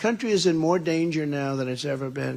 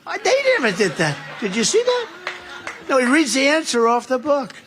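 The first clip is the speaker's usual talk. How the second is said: much higher, louder disbelief; incredulous; 9 semitones higher, 6 dB louder than usual. Real, not acted. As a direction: sound stunned that anyone would do that. Incredulous about reading answers.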